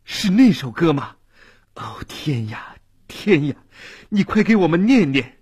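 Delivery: urgent, breathless begging; eager pleading. Acted. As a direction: plead eagerly, quick and high. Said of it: "急切地请求道".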